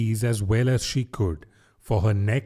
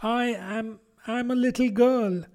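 doubtfully; 10 semitones higher, 3 dB quieter than usual. Acted. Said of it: "rather doubtfully".

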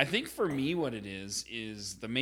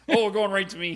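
laughing; 7 semitones higher, 8 dB louder, faster than usual. Real, not acted. Put on the spot, laughing.

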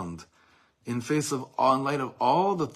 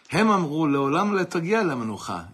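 loud recital; 6 semitones higher, 8 dB louder than usual. Acted. Reciting quoted poem, declaiming.

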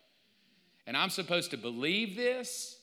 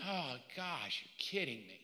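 muttered disbelief; 2 semitones lower, 9 dB quieter than usual. Retold or imitated. Skeptic at the back of church, muttering quietly. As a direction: grumble it under your breath. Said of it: "And I'm on the back going".

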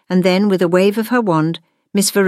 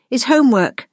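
raised, emphatic reveal; announcing; 8 semitones higher than usual. A narrator's reading. Gwendolyn showing monsters her homework, high announcement. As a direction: finish the announcement high, with a drop at the end. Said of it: "she announced".

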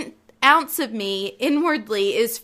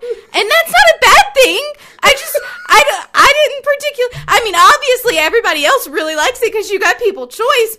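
higher and louder, laughing through tears; laugh-crying ramble; 8 semitones higher, 7 dB louder than usual. Real, not acted. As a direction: babble on while breaking up laughing. Rambling laugh-cry at own blunder.

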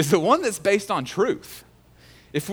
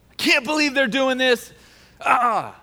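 mock rage; 8 semitones higher, 4 dB louder than usual. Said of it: "he rages at this".

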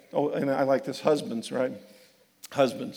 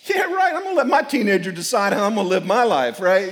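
sarcastic; 3 semitones higher, 7 dB louder than usual. Retold or imitated. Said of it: "I'm going like".

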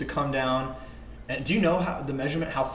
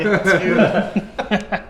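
laughing; 6 semitones higher, 4 dB louder than usual. Real, not acted. Echoing the joke.

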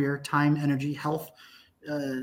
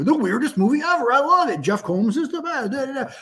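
higher, mock gushing fan voice; gushing reviewer; 7 semitones higher than usual. Reading a fan review aloud, higher mock gush. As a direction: play an over-excited online fan. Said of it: "one review".

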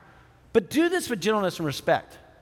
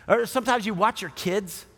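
ordinary talk

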